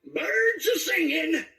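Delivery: put-on sing-song it like a stunned old miner; high, slow woozy old-timer; Dynamite voice. Shown as a demonstration. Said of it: "that's where the".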